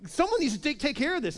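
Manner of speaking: high-pitched retold outrage; imitated frustrated young challenger; indignant. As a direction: demand action, mildly angry. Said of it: "And David is angry".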